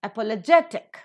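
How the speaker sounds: Apologetic accented on GET; stress before ik. Shown as a demonstration. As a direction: model apolo-GET-ic, punching GET. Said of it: "syllable before the suffix is given".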